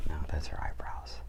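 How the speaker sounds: hushed whisper while drawing; soft unvoiced aside. Real, not acted. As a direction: whisper it as if concentrating on the page. Drawing, talking softly while sketching.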